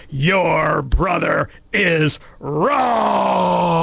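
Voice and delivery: hammed-up imperious proclamation, drawn out very slowly; imperious teacher. Wrathful teacher rebuking a first grader, mock imperious shout. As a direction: boom it out with a hugely stretched last word. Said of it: "raised her voice, and said in an imperious tone".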